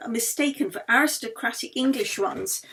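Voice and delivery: ordinary delivery, nothing marked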